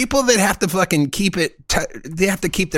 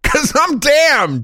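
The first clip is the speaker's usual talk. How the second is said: high, loud, drawn-out boast; triumphant mock shout; 11 semitones higher, 6 dB louder, much slower than usual. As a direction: shout it slowly as a gleeful punchline. Punchline as the damned.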